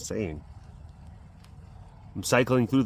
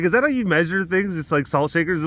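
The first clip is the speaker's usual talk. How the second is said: incredulous teasing; 6 semitones higher than usual. Retold or imitated. Retelling mock question to dad.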